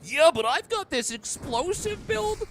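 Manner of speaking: high, loud, hyped gamer chatter; excited co-op teammate. Retold or imitated. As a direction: gush over the build like an overexcited friend. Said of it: "whoever I'm playing with is like".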